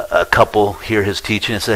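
usual speaking voice

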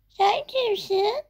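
put-on high, slow child voice; a lightly voiced surprised girl; startled child. Girl finds a stranger.

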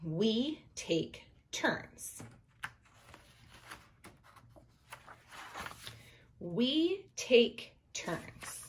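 slow, word-by-word reading with a pause after each; slow pointing read-aloud. Shown as a demonstration. Teacher modelling finger-point reading for young readers, slow and even. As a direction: read it slowly, one word per point. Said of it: "we wanna go playground".

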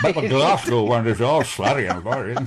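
loud, slurry, slack-mouthed Glasgow accent. Shown as a demonstration. Actor showing host the contrast, slurred Glasgow accent. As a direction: let the mouth go slack and slur it in Glaswegian. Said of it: "you have to have a slack mouth".

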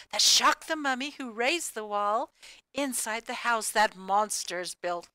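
plain reading voice

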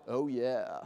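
low, smug trash talk; cocky line racer. Acted. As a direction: gloat quietly to yourself. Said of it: "and you're like".

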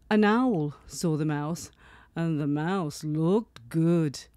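plain reading voice

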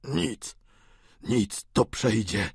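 slow, halting reassurance; lightly voiced unwell old man; ailing old father. A narrator's reading. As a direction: brush off the pain weakly. Ailing father plays down pain.

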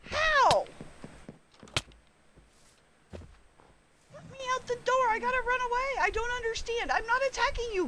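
high, frantic pleading; panicked high squealing at an attacking bear. Real, not acted.

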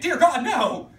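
high, very loud mock-horrified cry; mock horror. Teacher sarcastic about two pregnancies at school, mock horror. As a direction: exclaim as if scandalised, hammed-up.

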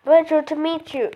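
ordinary delivery

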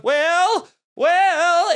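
high, loud searching shout; calling out. Retold or imitated. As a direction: call the name out into the dark, drawn out. Calls out for missing Will in story.

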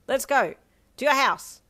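put-on high, loud, brash boy; boisterous rallying call; eager shout. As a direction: blurt it out like a command. Boar boy eagerly orders her along.

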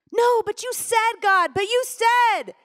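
high, strained cry of protest; desperate plea. Retold or imitated. Mother pleading with God not to take her daughter, high desperate cry. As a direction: wail it like a child refusing to let go.

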